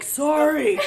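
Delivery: high, loud sorry into the mic; imitated loud apology. Imitating dad apologizing right into the mic, loud. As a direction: blare it out, mock apology. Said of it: "he's like in the mic".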